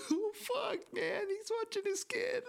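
very high, tearful mock wail; udawany płacz. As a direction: blubber it in an exaggerated squeak. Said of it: "his dad's crying, and then it's too late for me".

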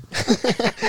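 sudden high-pitched giggle; a quick squeaky laugh. Real, not acted. Laughing after being called perv.

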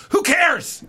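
high, offhand "who cares" tossed out; therapist's dismissive shrug. Retold or imitated. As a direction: brush it off like the therapist did. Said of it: "He'd go".

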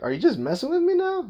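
high-pitched teasing question; mock suspicion. Patient to doctor after repeated prodding, mock suspicion. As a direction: playfully accuse them, pitch jumping up.

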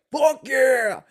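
high, loud triumphant cheer; exultant shout. Retold or imitated. Viewer cheers the villain's downfall, exultant shout. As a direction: yell it out in celebration.

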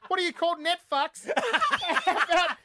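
very high, laughing mock outrage; laughing them out. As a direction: squeal the insults through scornful laughter. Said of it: "literally laughed them out of the room".

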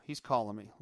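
usual speaking voice

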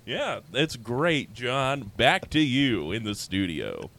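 hammy anchorman sign-off; news anchor handoff. Pretend. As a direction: toss it back to the studio, newsreader style. Mock newscaster handoff to John.

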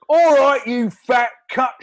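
put-on loud shouted drunken insult; drunk Stu. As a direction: bellow it like a drunk.

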